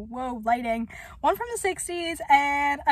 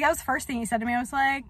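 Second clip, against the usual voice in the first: usual speaking voice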